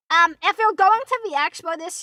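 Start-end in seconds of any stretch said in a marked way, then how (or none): none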